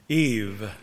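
serpent's low hiss of a name; lightly voiced tempting serpent; tempting devilish whisper. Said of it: "whispered the serpent".

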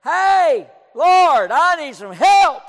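loud, high shout to God; crying out. Retold or imitated. Distressed man talking to God alone in the auditorium, crying out. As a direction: holler the plea at full force. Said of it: "I said just about like that, maybe a little louder".

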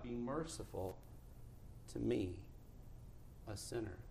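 very soft, low, humble prayer; hushed plea. Acted. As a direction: murmur it humbly with pauses between phrases. Hushed retelling of the publican's plea.

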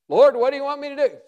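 an eager servant asking the Lord; eager question. Acted. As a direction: blurt it out, keen to serve. Eager Moses voiced.

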